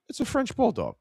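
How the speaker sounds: high, dopey customer voice on French bulldog. Retold or imitated. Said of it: "looks at me and goes".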